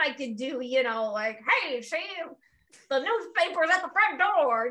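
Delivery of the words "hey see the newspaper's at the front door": a hint of an old-timey newsboy; sketchy old-timey gangster voice, barely shifted. Shown as a demonstration. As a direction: lightly play a 1920s tough guy. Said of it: "my old timey one".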